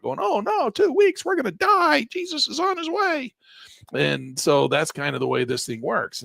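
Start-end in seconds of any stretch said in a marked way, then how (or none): 0.2-3.3 mock freaking-out investor. Mocking viewers fixated on two weeks of losses, very high mock panic. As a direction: ham up the investor who thinks the world is ending. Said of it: "just freaking out".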